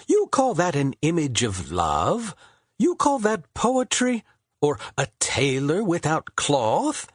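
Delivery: high, scornful poet voice; mocking Shakespeare. A narrator's reading. Shakespeare mocking Harvard's attempt at love poetry. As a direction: scoff at the clumsy image.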